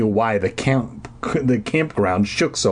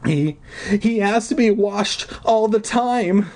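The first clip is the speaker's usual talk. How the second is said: exclaimed with a sob; 11 semitones higher than usual. Acted. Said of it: "Deirdre exclaimed with a sob".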